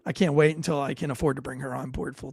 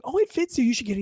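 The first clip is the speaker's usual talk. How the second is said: quick saleswoman's line, pitched up at the start; lightly voiced saleswoman; 7 semitones higher, faster than usual. Saleswoman urging a shirt on a customer, lightly imitated higher voice.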